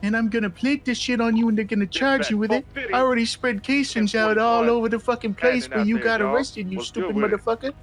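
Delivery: high, nasal, sneering crooked cop voice; mock a smug crooked cop in a high nasal whine; nasally bitch voice. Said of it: "that's a really good impression".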